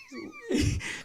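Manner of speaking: a sudden high-pitched laugh; burst of laughter. Real, not acted. Punchline of seat filler story.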